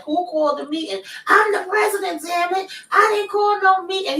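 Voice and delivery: put-on high, near-tears tantrum like a little kid; whiny tantrum. Recapper mimics the president insisting he called no meeting, in a high tantrum whine. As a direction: squeal it like a kid about to cry. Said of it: "He looked like he ready to cry, like a little kid that's having a temper tantrum".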